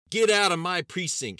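furious, very high full shout; loud shout. A narrator's reading. Sheriff throwing federal agents out, shouting loudly. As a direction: bellow the order. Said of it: "Sheriff Woods shouted loudly".

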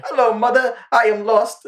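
high, timid, lost little man; meek and polite, like a lost boy; Steven's voice. Retold or imitated. Fan imitating the character Steven talking to his mother. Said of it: "switches to Steven".

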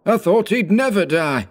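put-on very high, thin old merchant; high squeaky merchant. A narrator's reading. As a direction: squeak it like a snuffy old man. Third merchant continues.

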